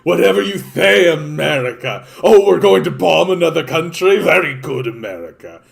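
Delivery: high, loud, fawning sycophant gushing at America; groveling toady. Pretend. Canada as America's toady, sucking up in a high fawning voice. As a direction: simper and gush like a spineless yes-man. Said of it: "We either just become, like".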